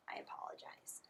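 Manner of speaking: a sheepish whispered sorry; whispered apology. Real, not acted. Teacher apologizing to the class for forgetting the comma, whispered. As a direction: breathe the apology out without voice.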